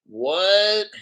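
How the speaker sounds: ordinary talk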